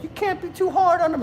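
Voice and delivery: high mock wife voice; a lightly mocking high voice for the imagined wife; imitating wife. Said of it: "she'd be like".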